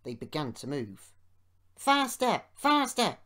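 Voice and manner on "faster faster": high, loud urging shouts; rowdy trucks egging each other on; grumbling trucks. A narrator's reading. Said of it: "they grumbled".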